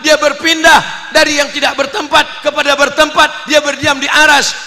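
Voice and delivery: loud, high-pitched declaiming; preaching at full force. Acted. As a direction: thunder the doctrine out to the congregation.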